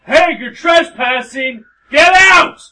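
slow furious high yell; shouting at trespasser. Real, not acted. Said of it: "you yell out the window".

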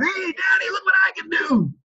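imitated kid calling out in a high voice; high-pitched mock holler, long and carrying; child's yell. Young son yelling to Dad across the yard, imitated excited call. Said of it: "he yells at me across the yard".

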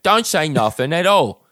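hammed-up Cockney film-star accent; Michael Caine. Co-host redoing the comment's line, Cockney impression. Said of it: "You kinda had a Michael Caine".

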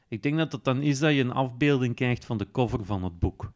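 ordinary talk

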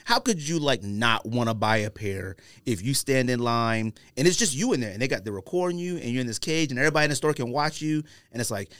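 usual speaking voice

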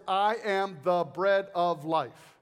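"I am the bread of life." said very slowly, each word weighted; slow and weighty. Shown as a demonstration. Preacher quoting Jesus's six words, slow and solemn. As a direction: lay down every word slowly. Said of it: "He said six words".

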